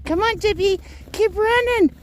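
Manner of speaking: very high, loud encouraging call; calling the dog. Real, not acted. Urging Tippy through the snow, very high and loud. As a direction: urge the little dog on.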